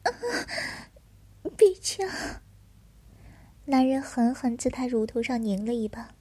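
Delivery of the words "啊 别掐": sharp high cry of pain. Acted. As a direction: yelp as if pinched. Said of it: "吃痛".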